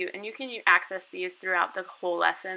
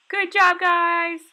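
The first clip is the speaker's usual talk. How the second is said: much higher and louder cheer; enthusiastic praise; 11 semitones higher, 11 dB louder, much slower than usual. Shown as a demonstration. Teacher cheering young students online, loud and high. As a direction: burst out with big praise.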